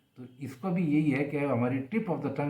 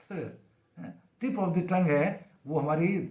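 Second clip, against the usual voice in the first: ordinary talk, nothing marked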